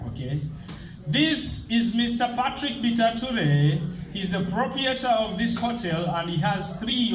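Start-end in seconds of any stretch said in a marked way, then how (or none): none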